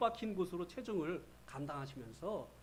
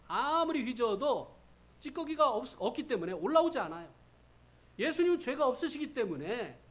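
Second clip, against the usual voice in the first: louder, higher sermon emphasis; a preacher's raised voice; forceful preaching; 5 semitones higher, 7 dB louder than usual. Acted. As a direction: drive the point home from the pulpit.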